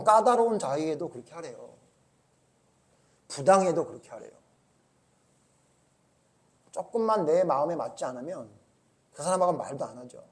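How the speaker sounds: normal talking voice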